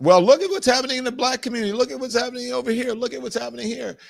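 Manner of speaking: high, fast mocking imitation of a critic. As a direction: squeak it out like a sneering critic.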